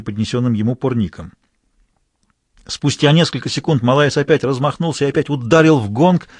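ordinary delivery, nothing marked

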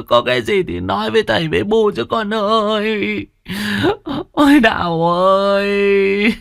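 high keening wail for a dead daughter; wailing grief. Acted. Grieving mother wails over daughter's coffin, high keening. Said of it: "rú lên khóc to hơn".

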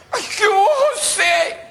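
high, squealed comic greeting; flustered woman. Acted. Paola greets José from her village, embarrassed.

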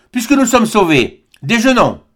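ordinary delivery, nothing marked

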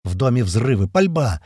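ordinary delivery

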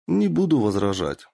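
usual speaking voice

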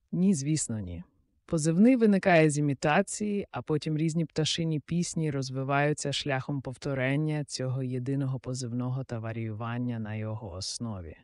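usual speaking voice

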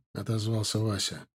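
ordinary delivery

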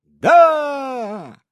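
high, loud drawn-out roar; soldiers' yell. A narrator's reading. Warriors answer their commander, yelling it out high. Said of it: "внезапно дружно заорали воины".

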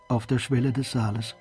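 ordinary talk, nothing marked

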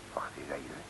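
hushed, unvoiced wait; whisper. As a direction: whisper it to yourself. Sudden whispered realization.

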